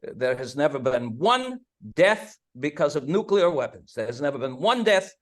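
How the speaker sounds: hammed-up, badly faked Hungarian accent; terrible Hungarian accent. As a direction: play Teller with a clumsy Hungarian accent, insisting on each denial. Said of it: "a terrible Hungarian accent".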